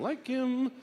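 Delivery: high-pitched little gloat; delighted approval. Acted. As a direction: pipe up in high, pleased approval. Prosecutor's delight, acted.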